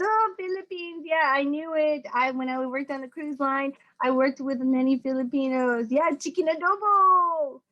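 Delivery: put-on eager Indian accent, stretch out "adobo"; Indian guy. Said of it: "Indian guy, is like".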